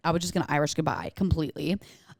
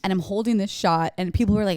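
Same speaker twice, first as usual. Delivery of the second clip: ordinary delivery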